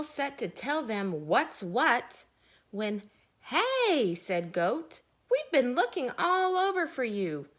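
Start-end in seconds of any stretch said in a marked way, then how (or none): 3.4-4.1 calling out. Acted. Goat calling out. 5.3-7.5 excited goat. Acted. Goat rushing in.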